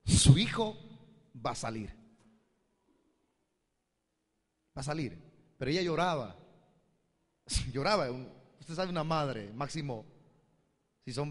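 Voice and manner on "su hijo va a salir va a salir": prophetic declaration. Retold or imitated. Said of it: "Y le dije".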